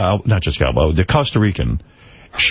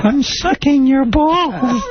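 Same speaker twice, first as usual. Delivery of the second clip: high, drawn-out, laughing falsetto; mock submissive partner; 13 semitones higher, much slower than usual. Host answering the spy's threat, hammed-up submission. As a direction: play the compliant victim in a slow high voice.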